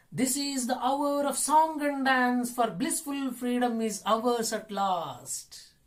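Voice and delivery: loud, high, sustained declaiming; poem recitation. Acted. Teacher reading verse to children, high ringing recitation. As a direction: recite it slowly rising high, drawing out the last words.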